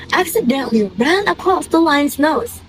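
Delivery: plain reading voice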